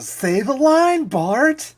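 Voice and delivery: high, loud, drawn-out demand; imitated cartoon chorus egging someone on; Simpsons crowd. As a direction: draw it out like an eager cartoon audience. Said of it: "the scene from The Simpsons".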